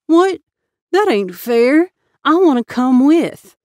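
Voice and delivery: put-on high, loud childish outcry; a kid objecting, loud and high; whiny protest. Boy protests being left behind.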